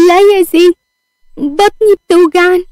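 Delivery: high, pained wail. A narrator's reading. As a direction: plead through belly pain, pitch held high. Acted cry of stomach pain. (بتتوجع وبصوت عالي)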